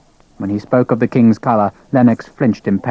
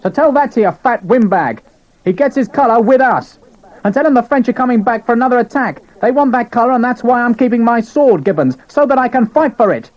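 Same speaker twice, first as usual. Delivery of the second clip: angry defiance; 12 semitones higher than usual. Acted. Sharp angrily defying Gibbons.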